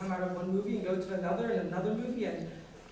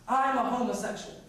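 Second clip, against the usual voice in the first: raised, louder declaration; bold announcement; 5 semitones higher, 5 dB louder than usual. Retold or imitated. Student declaring himself to the principal, boldly. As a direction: announce it higher and louder, as if to an office.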